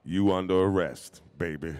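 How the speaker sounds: clipped cop order with a wink; robot cop voice. Acted. Voice actor showing off his robot cop, a hint of it. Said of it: "Earl, the robot cop".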